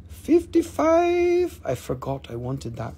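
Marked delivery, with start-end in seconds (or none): 0.1-1.6 high drawn-out mock surprise; a mock-astonished price echo; surprised echo. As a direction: stretch it out high, as if shocked at the price. Seller echoing a price, drawn out.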